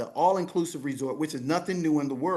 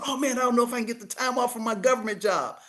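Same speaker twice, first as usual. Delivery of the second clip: imitating excited friend; 7 semitones higher, faster than usual. Voicing friend's hesitant excitement.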